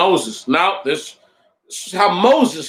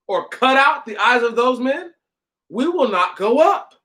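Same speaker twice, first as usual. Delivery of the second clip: mildly defiant scripture reading with rising questions; ungrateful complaint; 8 semitones higher than usual. Acted. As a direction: read the grievance as a lightly acted challenge. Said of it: "just hear how ungrateful all this sounds".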